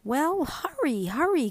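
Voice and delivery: put-on whining child's cry of impatience; impatient cry. A narrator's reading. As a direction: pester a slowpoke to get moving. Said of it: "cried Mink".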